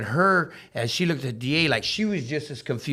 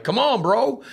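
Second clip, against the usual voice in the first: much higher, louder pleading call; urging friend; 12 semitones higher, 6 dB louder, slower than usual. Retold or imitated. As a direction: egg your buddy on, pitched way up. Urging Woods in court.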